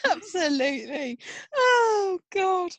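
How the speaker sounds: high, breaking words after a laughing fit; real, spent amusement; recovering from laughter. Real, not acted. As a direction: sigh it out through the last giggles.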